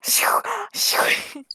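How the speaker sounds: voiceless, hushed "shoo, shoo, shoo"; whispered shooing. Acted. Beast flipped toward the wall, whispering shoo. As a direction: shoo them off in a pure whisper.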